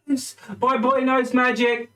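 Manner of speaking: high, excited proud father; overjoyed dad cheering, acted; enthusiastic dad. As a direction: whoop with pride from the back. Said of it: "one really enthusiastic dad at the back".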